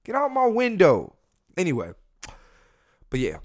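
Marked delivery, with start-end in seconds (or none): 0.0-1.2 high-pitched exasperated yell at someone outside; re-enacting his high-pitched holler; retold yell. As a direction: yell it high, as if through the window. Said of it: "And I yelled at him".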